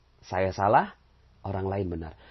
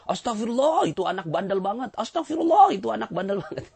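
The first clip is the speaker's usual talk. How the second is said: exasperated and much louder, pitched high; blaming istighfar; 10 semitones higher, 5 dB louder than usual. Shown as a demonstration. A parent sighing istighfar at a naughty child, loud exasperated blame. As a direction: scold the naughty kid while praying. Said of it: "tapi ngomongnya gini".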